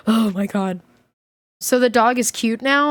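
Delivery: ordinary delivery, nothing marked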